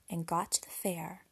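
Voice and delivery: plain reading voice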